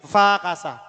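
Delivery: befakasa with be almost inaudible; correct pronunciation. Shown as a demonstration. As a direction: say "befakasa" nearly as FA-kasa. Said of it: "Jadi kayak nggak bunyi". (befakasa diucapkan seolah "fakasa")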